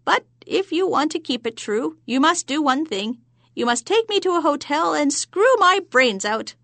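high sly hag voice; witch voice. Acted. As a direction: lay out terms like a sly hag. Hag demands her price.

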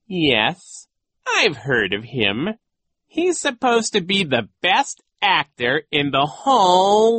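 slow, loud, high theatrical voice; boastful disguised villain. A narrator's reading. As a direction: brag with drawn-out emphasis. Disguised villain brags as coach.

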